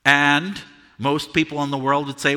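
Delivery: ordinary talk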